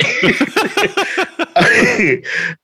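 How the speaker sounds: squealing laughter pitched far up; delighted laughing fit; long high laugh. Real, not acted. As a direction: keep giggling helplessly. Laughing at own punchline.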